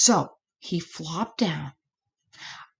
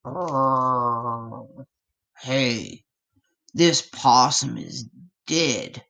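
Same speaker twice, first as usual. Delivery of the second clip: lightly voiced low growling cat; a hint of a snarling swamp cat; low growly critter voice; 5 semitones lower, slower than usual. A narrator's reading. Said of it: "it snarled".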